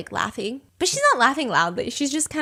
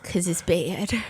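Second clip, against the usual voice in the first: ominous hush; 5 semitones lower, 9 dB quieter, slower than usual. Retold or imitated. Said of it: "They said".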